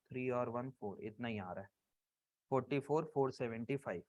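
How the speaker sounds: low, soft counting to oneself; totting up aloud. Real, not acted. Teacher adds up figures aloud to himself.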